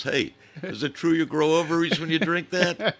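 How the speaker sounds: loud, high-pitched heckle across a bar; imitating a yell across. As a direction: call it out like the guy at the bar. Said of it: "guy yells across".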